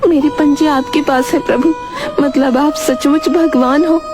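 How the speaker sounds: high-pitched cow voice, newly convinced; amazed cow. Acted. Cow realises she faces the real God, amazed. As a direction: wonder aloud as the cow, voice high.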